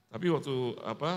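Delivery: ordinary talk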